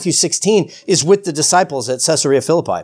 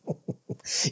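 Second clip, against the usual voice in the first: soft, breathy chuckle to oneself; hushed little imitated laugh; quiet chuckle; 16 dB quieter than usual. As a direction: chuckle under the breath, imitating private amusement. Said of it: "she does it quietly".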